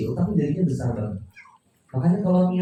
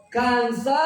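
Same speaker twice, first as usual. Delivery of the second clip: put-on sharp raised call of the child's name; angry call; 6 semitones higher than usual. Parent about to scold the child, calling her name sharply. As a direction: snap the name up high at a naughty kid. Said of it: "Anak saya misal Kansa".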